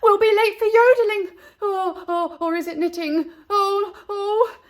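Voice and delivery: high, loud, frazzled nanny voice; a fussy nanny hunting for her things; flustered nanny. A narrator's reading. Nanny panics about lessons and lost calendar, high frazzled voice.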